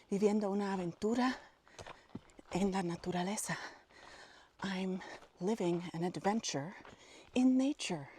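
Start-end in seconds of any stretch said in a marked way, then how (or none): none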